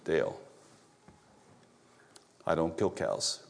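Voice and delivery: low, soft, grave voice of God. Retold or imitated. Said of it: "I heard this".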